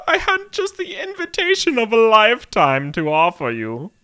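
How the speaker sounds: high, theatrical society lady; squiggling with delight. Acted. Lady offering friends premiere tickets, high delighted voice. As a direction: announce it in a high, delighted gush. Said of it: "squiggling with delight".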